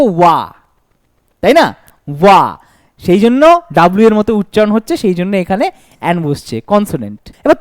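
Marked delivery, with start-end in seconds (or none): 0.0-2.6 ওয়া said short and low; teacher showing the wa sound; isolated wa sound. Shown as a demonstration. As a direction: clip out ওয়া on its own. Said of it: "উচ্চারণ কি আসছে দেখো".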